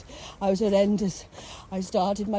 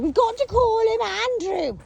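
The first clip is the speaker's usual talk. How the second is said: put-on childish insistence; 13 semitones higher, 5 dB louder than usual. Child self insisting on name.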